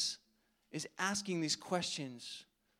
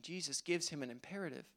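ordinary delivery

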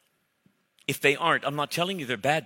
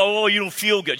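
sarcastic louder high whine; mock his self-pity; mocking a friend's hurt feelings; mocking sympathy; 7 semitones higher, 6 dB louder than usual. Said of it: "Doesn't feel".

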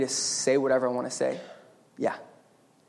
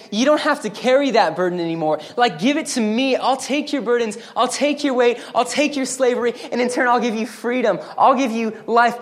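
voicing Jesus; 6 semitones higher, 4 dB louder than usual. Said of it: "So Jesus is saying".

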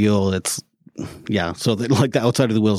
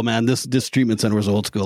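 ordinary talk, nothing marked

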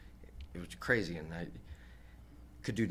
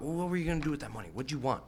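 rapid questioning; 6 semitones higher, faster than usual. Retold or imitated. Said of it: "He's like".

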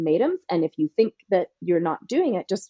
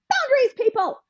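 emphatic call out. Real, not acted.

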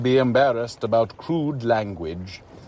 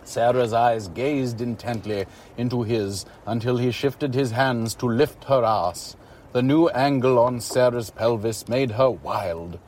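ordinary delivery, nothing marked

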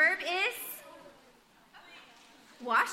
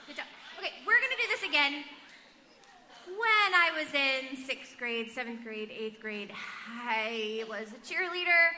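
ordinary talk

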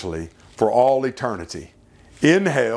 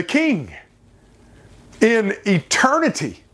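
climactic preaching; 4 semitones higher than usual. Acted. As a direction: lift the congregation toward eternity.

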